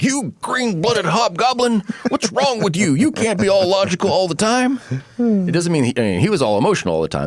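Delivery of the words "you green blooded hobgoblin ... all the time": high, slow scolding voice; mock exasperated ship doctor; McCoy scolding Spock. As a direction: chide him like an exasperated doctor. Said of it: "or like".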